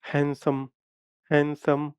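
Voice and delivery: "हैंडसम" carefully sounded out syllable by syllable; pronunciation demo. Shown as a demonstration. Teacher showing students how the mouth opens, careful "हैंडसम". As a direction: say "हैंडसम" deliberately, each mouth opening distinct. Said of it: "in words को pronounce करो".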